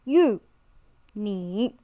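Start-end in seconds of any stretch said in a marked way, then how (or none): 1.2-1.7 你 said in Mandarin third tone, low and dipping. Shown as a demonstration. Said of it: "the third tone".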